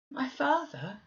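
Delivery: put-on high, startled child's cry; a boy blurting out in confusion; perplexed cry. A narrator's reading. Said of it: "he cried in strange perplexity".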